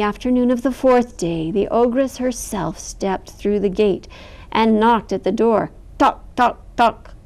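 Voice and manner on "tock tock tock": knocking sound. A narrator's reading.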